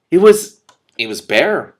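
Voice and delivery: loud, slow halting reply; flustered. A narrator's reading. The prince struggles to describe the wasteland, loud and halting. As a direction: stumble over the words.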